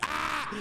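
sudden high "ah" as a sample scream; mock scream, held back in volume; demonstrated scream. Shown as a demonstration. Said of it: "just screaming in general. Kinda like".

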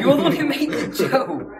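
laughing while talking. Real, not acted. Laughing at a joke.

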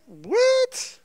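high, drawn-out squeal of disbelief; incredulous what. Acted. Driver finding half a tank of gas, incredulous. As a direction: stretch it out in stunned disbelief.

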